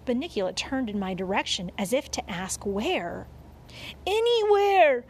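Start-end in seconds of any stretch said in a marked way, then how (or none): 4.1-5.0 high, loud desperate cry; urgent cry. A narrator's reading. Dog answering the bunny's questioning look, crying out. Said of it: "I cried".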